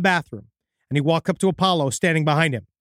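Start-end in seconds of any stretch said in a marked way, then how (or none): none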